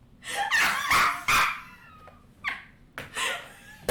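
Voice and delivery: a burst of high, loud cackling laughter; loud shrieking laugh. Real, not acted. Player reacts to binding spell.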